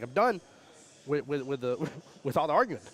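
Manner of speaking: loud mock scolding; hammed-up yell at quarrelers; retold yell. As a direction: shout down the argument, half laughing. Retold yelling at arguing players.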